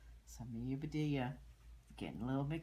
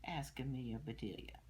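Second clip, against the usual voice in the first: ordinary delivery, nothing marked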